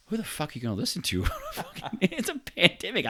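high, fast incredulous rant; incredulous outburst. Real, not acted. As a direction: blurt it out in disbelief. Outraged rant at quote.

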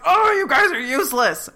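fed-up mock scold; exasperated. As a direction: throw up your hands at them. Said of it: "she's like".

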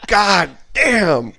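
high mocking drill sergeant exclamation. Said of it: "they're like".

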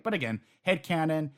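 ordinary talk, nothing marked

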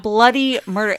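usual speaking voice

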